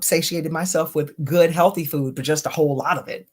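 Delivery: ordinary talk, nothing marked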